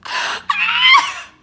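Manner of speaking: high, loud scream of disbelief; exasperated scream. Acted. Hearing his flight just landed, exasperated scream. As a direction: scream out comic frustration. Reacting to his surprise landing.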